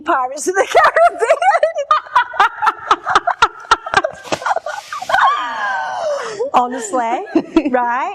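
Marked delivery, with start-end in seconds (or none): 3.7-6.5 long high-pitched cackle; shrieking laugh. Real, not acted. Cracking up at co-host's pirate crush, shrieking laugh. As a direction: burst into a drawn-out shriek of laughter.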